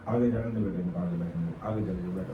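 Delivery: low, soft practical instruction; quiet aside. Real, not acted. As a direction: drop low and soft to direct someone aside. Aside directing listeners to seats. (धीमी, दबी आवाज़ में निर्देश)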